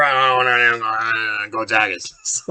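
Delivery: slow, low Cajun mumble; Ed Orgeron voice. Retold or imitated. Podcaster doing Orgeron, slow low Cajun mumble. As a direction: slur it like a gruff Cajun coach. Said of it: "Ed Orgeron".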